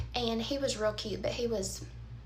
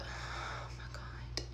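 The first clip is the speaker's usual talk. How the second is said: whispered dismay; 13 dB quieter, much slower than usual. Real, not acted. Dismayed aside as he vomits.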